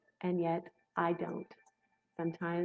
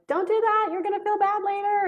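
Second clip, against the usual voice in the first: high, loud warning yell; inner voice yelling; 14 semitones higher, 7 dB louder than usual. Retold or imitated. Inner voice warns her mid-outburst, loud high yell. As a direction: shout it as a frantic warning to yourself.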